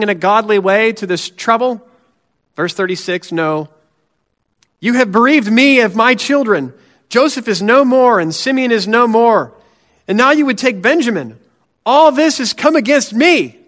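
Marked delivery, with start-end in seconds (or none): 4.8-13.6 higher, louder self-pitying lament; lamenting Jacob. Acted. Jacob accuses his sons of bereaving him, loud and high. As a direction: wail it as an aggrieved old father.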